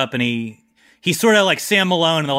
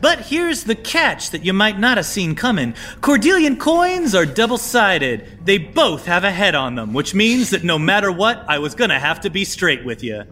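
raised, playful swagger; higher, teasing charmer; smooth high-status schemer; 6 semitones higher than usual. Acted. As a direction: toy with them like a cool con man. NPC Snake Professional speaking.

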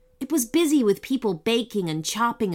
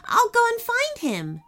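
happy. Acted. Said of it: "Ananya said happily".

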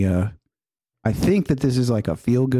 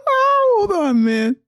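very high, breaking with laughter; laughing; much slower than usual. Real, not acted. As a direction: gasp it out mid-laugh. Cracking up at the video.

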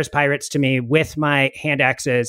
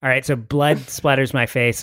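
ordinary talk, nothing marked

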